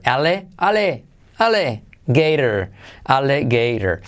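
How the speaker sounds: "alligator" built up from "ale, ale" plus "gator"; syllable drill. Shown as a demonstration. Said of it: "alligator se pronuncia así". ("alligator" por partes: "ale", "gator")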